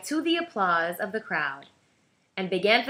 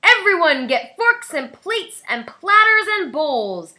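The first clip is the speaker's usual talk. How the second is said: loud, higher call to the crowd; shouting; 7 semitones higher, 7 dB louder than usual. A narrator's reading. Big Anthony shouting to the town from the fountain. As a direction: call out across the square. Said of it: "and shouted".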